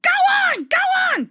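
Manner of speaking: very high, loud squeaky taunt; giggling trucks. A narrator's reading. Silly trucks giggling as they push Thomas downhill. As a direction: tease him, silly and gleeful. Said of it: "they giggled in their silly way".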